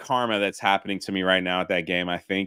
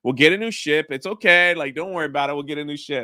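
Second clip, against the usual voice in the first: higher, soothing father comforting his son; a mock gentle dad calming his kid; reassuring dad; 6 semitones higher than usual. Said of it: "He's like".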